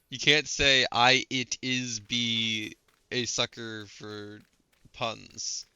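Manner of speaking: "I, it is be" spelled out slowly and loudly; pedantic mock quote. Correcting partner's grammar, pedantic slow quote. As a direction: pull "I, it is be" apart word by word, loud.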